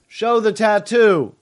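high, slow, insistent call from a stranger; crowd demand. Acted. A crowd member demanding to see the tattoo, high and slow. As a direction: demand it, drawn out and pitched high.

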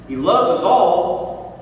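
ordinary delivery

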